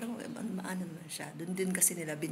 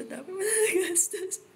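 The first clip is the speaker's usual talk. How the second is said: higher, louder, laughing through tears; laughing cry; 13 semitones higher, 7 dB louder than usual. Real, not acted. Telling fiancé he spent so much, laughing and crying. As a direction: crack into laughter while crying. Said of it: "first day ko pala to dito".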